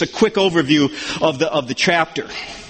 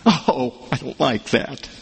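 low, slow, soft grumble of a reluctant listener; grumbling objector; 8 semitones lower, 4 dB quieter than usual. Pretend. A listener grumbling back at the preacher, low and slow. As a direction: sulk it like someone who dislikes the truth.

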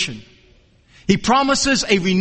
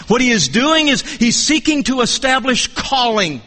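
ordinary talk, nothing marked

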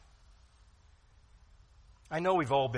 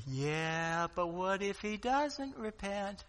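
skeptical objector; 5 semitones higher, slower than usual. Pretend. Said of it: "some are gonna say".

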